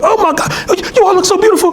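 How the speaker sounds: very high, imitated onlooker; hammed-up high-pitched admiration; mock gushing admirer. Voices admiring onlookers in a story.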